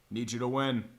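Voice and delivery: ordinary delivery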